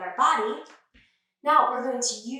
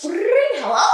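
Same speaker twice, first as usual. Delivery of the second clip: loud greeting; 6 semitones higher, 8 dB louder than usual. Acted. Greeting the class loudly.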